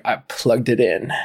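low, deadpan sarcastic reply; drunken sarcasm. Retold or imitated. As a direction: answer as if it were obvious, voice dropped. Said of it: "such a fucking sarcastic asshole".

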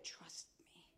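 a tender whispered promise; hushed, intimate whisper; soft whisper. Acted. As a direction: whisper it close, barely voiced. Whispered reassurance as God's voice.

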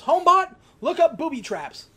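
high, loud call to the smart house; kid commanding. Retold or imitated. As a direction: bark the command at the device.

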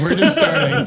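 gleeful laugh while repeating. Real, not acted. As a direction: crack up through the words. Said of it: "repeat, laughing, louder".

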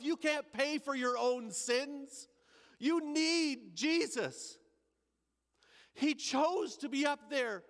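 slow, weighty preaching voice; emphatic preaching. Acted. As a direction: drive each line home slowly. Preacher driving the point home.

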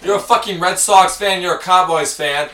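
loud, fake angry tirade; mock rant. Friend performing a mock angry rant at the roommate. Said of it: "You did better than me".

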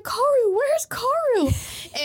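panicked high cry, last words drawn out; mock freak-out, high and loud; Vivi. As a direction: call out frantically for a lost pet, hammed up. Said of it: "Vivi starts freaking out".

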